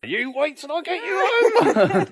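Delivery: high, slow, playful threat; mock threat. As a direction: tease with a mock warning.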